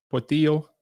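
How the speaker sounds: put-on Spanish accent on the name; mock Spanish pronunciation; fake Spanish accent. Shown as a demonstration. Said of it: "Y'all like my fake Spanish accent".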